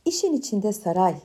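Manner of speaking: usual speaking voice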